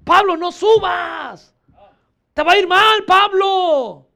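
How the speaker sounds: high, drawn-out cry of warning; acted urgent appeal; loud pleading. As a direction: call after him, begging loudly. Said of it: "Están rogando".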